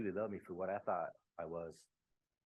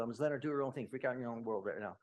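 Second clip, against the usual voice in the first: usual speaking voice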